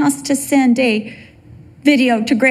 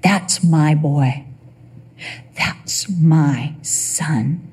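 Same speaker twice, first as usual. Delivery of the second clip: slow, low fatherly pride; low, drawn-out, heartfelt claim of a son; proud father; 7 semitones lower, much slower than usual. Acted. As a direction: savour each word as a proud father. God voiced as proud Father.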